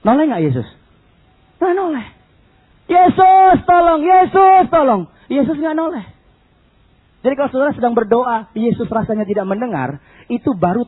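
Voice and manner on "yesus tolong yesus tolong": high, loud repeated call; urgent shouted plea; crying out to Jesus. Retold or imitated. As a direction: shout for help, pushing each name. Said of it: "teriak sama Yesus".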